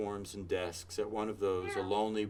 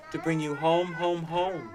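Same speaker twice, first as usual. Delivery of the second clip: put-on eager little girl; 6 semitones higher than usual. A narrator's reading. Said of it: "said the child".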